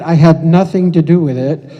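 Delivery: loud, emphatic aside to the audience; loud disclaimer. Acted. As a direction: belt it out to deny any part in it. Joking disclaimer of the pupils' volunteering.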